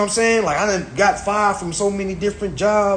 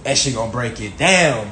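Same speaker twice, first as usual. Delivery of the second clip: ordinary talk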